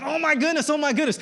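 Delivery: high-pitched mock fretting; panicking. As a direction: repeat it in a high flutter of worry. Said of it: "I'm panicking".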